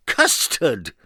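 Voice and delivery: character voice, delighted; delighted exclamation. Acted. Mister Nonsense seeing snow, delighted cry. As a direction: announce it with glee.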